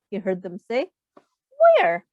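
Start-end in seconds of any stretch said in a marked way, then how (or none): 1.5-2.1 high, loud puzzled "Where?". Acted. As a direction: look around, bewildered. Pinky looking around, puzzled.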